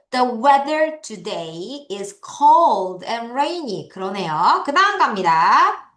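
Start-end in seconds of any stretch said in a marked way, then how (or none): 0.1-3.9 very slow, word by word read-along; slow choral reading. Shown as a demonstration. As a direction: read it slowly so the class can follow. (아주 천천히 또박또박 같이 읽기)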